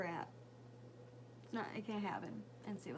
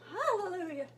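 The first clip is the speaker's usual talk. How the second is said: hallelujah cheer; 8 semitones higher, 5 dB louder than usual. Real, not acted. Relief son back at school.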